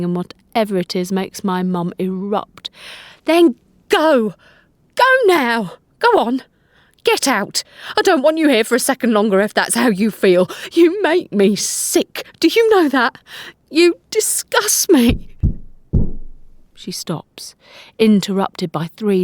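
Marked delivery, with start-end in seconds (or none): 3.3-15.4 mum screaming at dad, voice cracking into tears; furious shouting mum. A narrator's reading. Mother erupting at father in a furious, tearful shout. 16.7-17.5 whisper. A narrator's reading. Narration drops to hush.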